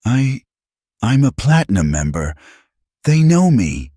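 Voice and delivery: nervous, high stammer. A narrator's reading. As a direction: stumble over the words in panic. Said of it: "then stammered".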